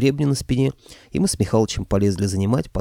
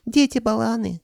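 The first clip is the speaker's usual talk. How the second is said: very high, piping creature voice; sighing dragon head; 13 semitones higher than usual. A narrator's reading. Middle head lamenting its missed share, high sighing voice. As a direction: sigh it in a falsetto. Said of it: "вздохнула средняя".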